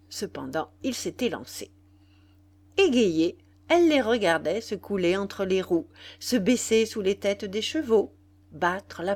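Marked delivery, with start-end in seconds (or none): none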